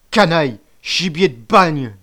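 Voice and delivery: loud, high, drawn-out insult; bellowing. A narrator's reading. Furious boy cursing the traitor, bellowing. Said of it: "beugla Camus".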